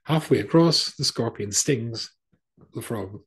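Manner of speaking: usual speaking voice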